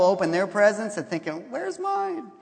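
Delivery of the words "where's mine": high, whiny where's mine; a petulant child's complaint, pitched up; whiny jealous child. Retold or imitated. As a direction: whine it like a jealous kid. Jealous thought voiced.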